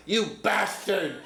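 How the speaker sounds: high shouted curse breaking into a laugh; laughing yell. Retold or imitated. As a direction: yell it but crack up. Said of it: "Tommy turns to me and yells".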